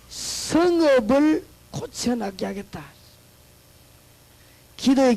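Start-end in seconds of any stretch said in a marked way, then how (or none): 0.1-2.8 slow solemn proclamation. Acted. Quoting God's promise slowly.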